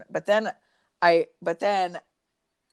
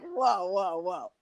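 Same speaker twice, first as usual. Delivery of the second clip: mock incredulous; 7 semitones higher, slower than usual. Her incredulous mocking reply.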